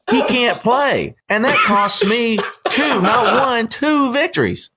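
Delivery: high, slow, disbelieving complaint; exasperated rant. Real, not acted. Fantasy owner ranting to cohosts about a lost win.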